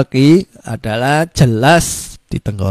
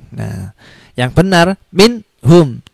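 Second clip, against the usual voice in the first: ordinary talk, nothing marked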